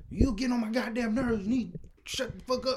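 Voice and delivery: high-pitched mock yell of an angry mom. Mom yelling at kid on birthday.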